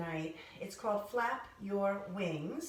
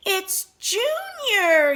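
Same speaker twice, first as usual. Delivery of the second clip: high, loud, slow proclamation; excited shout; 13 semitones higher, 12 dB louder, much slower than usual. Acted. Father bird greeting his newly hatched baby, excited shout. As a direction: shout it out, stretching the name. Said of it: "he shouted".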